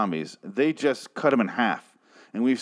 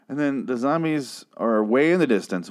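ordinary talk, nothing marked

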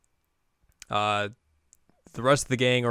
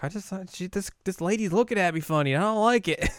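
put-on high childish whine; whiny little girl voice; 9 semitones higher than usual. Spooked by the staring lady, high childish whine. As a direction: sound like a spooked little kid. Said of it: "She's like".

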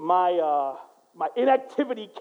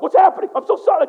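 lightly voiced dog, higher and louder; a dog cowering in mock alarm; a hint of a frightened dog; startled dog; 8 semitones higher, 8 dB louder, much faster than usual. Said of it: "the dog was like".

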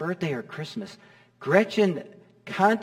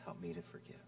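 unvoiced whispered plea; whispered prayer; 18 dB quieter, faster than usual.

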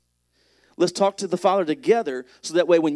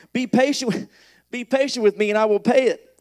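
pleading; 7 semitones higher, 7 dB louder than usual. A narrator's reading. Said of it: "he pleaded".

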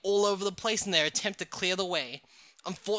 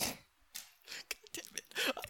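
whispered aside; 13 dB quieter, much slower than usual. Real, not acted. Reader's unvoiced aside.

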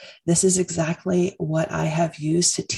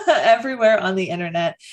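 laughing; 3 semitones higher, 4 dB louder, faster than usual. Real, not acted. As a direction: still laughing, a bit louder. Laughing while listing.

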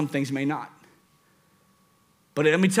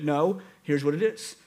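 ordinary talk

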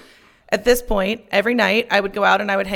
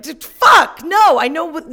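loud, high, exasperated yell at customer service; angry outburst; 6 semitones higher, 5 dB louder than usual. Retold or imitated. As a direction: blow up at the phone agent. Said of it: "'cause I was like".

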